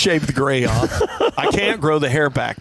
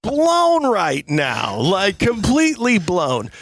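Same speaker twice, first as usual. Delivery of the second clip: mind blown; 8 semitones higher, much slower than usual. Real, not acted. Stunned by the show's success.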